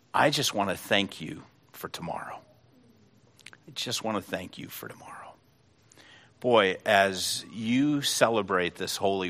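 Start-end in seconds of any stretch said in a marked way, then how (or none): 3.5-5.3 put-on hushed child's whisper of gratitude; whispered thanks. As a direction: repeat the line as a soft, tender whisper. Boy repeats thanks, hushed.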